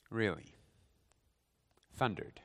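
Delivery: low, quiet sceptical question; understated disbelief; deadpan doubt. Real, not acted. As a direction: drop it very soft and low, unconvinced. Sceptical reaction to thunder explanation.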